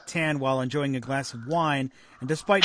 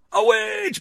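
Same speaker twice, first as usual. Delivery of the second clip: mock witch trial; 4 dB louder than usual. Monty Python witch logic quote.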